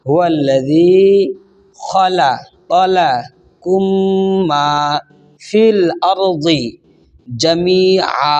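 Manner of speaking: slow melodic chant with long held vowels; Quran recitation. Shown as a demonstration. Teacher reciting the joined verse for students, slow chant. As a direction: stretch each word into a recited line. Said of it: "আবার repeat করে আসি।".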